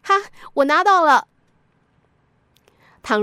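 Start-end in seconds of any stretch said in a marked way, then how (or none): 0.0-1.3 higher, louder crow of pride; triumphant announcement. A narrator's reading. Family member showing off the chipped plate at dinner, gleefully triumphant. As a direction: announce it proudly, like winning a prize. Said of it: "洋洋得意地宣布".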